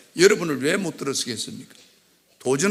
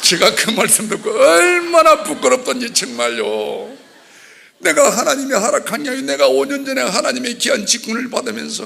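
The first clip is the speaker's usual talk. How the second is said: shamed outcry; 7 semitones higher, 6 dB louder than usual. Acted.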